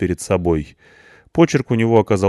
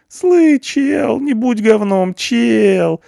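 very high, loud begging whine; pleading whine; 6 dB louder, much slower than usual. A narrator's reading. Punk begs the barman to drop charges, pleading whine. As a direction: beg him to drop the charge. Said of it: "заныл просительно Панк".

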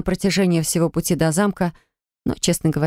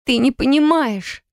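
high, slow, desperate cry; shouting; 6 semitones higher, slower than usual. Acted. Sher shouts at Drafok in despair. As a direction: cry it out in frustration. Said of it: "закричала я".

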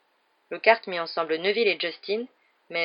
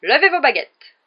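much louder and higher; shouted command; 8 semitones higher, 9 dB louder, slower than usual. Acted. Lockhart ordering the duellists, shouting. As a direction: bark it across the hall. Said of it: "cria Lockhart".

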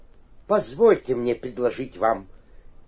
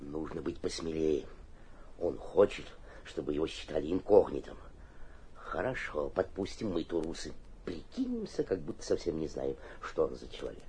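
scheming aside; 8 semitones lower, 10 dB quieter than usual. Acted.

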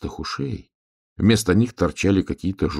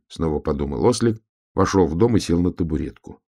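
usual speaking voice

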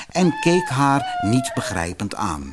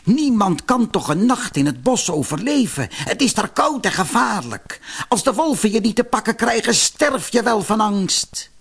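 high-pitched exasperated lament; voiced villainess, incredulous and high; sighing disbelief; 10 semitones higher than usual. A narrator's reading. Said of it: "verzuchtte ze".

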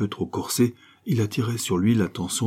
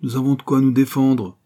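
louder, higher scornful sneer; 5 semitones higher, 5 dB louder than usual. A narrator's reading. Diana sneering at her frightened lover. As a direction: scoff at his fear. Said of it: "ricana l'ex-institutrice".

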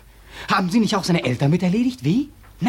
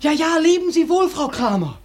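mock polite farewell; 9 semitones higher than usual. Mock farewell to Frau Kramer.

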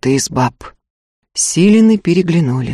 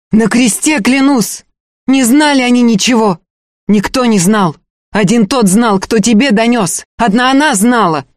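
higher, insistent sworn denial; raised-pitch, frantic protest; desperate hoarse cry; 6 semitones higher than usual. A narrator's reading. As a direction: swear it urgently in short outbursts. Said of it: "отчаянно, хрипло выкрикнула Устья".

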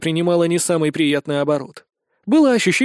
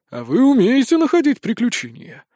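higher, teasing remark; wry amusement; 6 semitones higher than usual. A narrator's reading. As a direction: tease them lightly. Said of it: "усмехнулся Боингч". (с лёгкой усмешкой)

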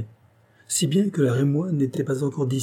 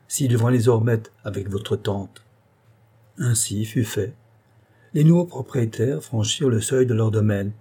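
usual speaking voice

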